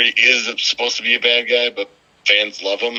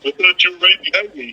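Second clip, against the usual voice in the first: mock Sami excuse; 10 semitones higher than usual. Joins in voicing Sami.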